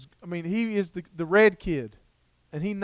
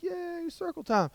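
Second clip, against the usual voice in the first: put-on much higher, slow, drawn-out child's cheer; mock kid cheer; 12 semitones higher, 4 dB quieter, slower than usual. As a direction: cheer like a whole class of small kids. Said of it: "All the kids are like".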